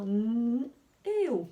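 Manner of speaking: "n" held as a long hum, then "o"; sounded out. Shown as a demonstration. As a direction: separate the sounds "n" and "o", lengthening the n. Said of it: "j'entends d'abord".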